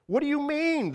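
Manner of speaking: very high, alarmed question; high-pitched panicked disbelief; afraid cry. A narrator's reading. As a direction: cry out in sudden fright, pitch leaping up. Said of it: "the dangling man cried, afraid".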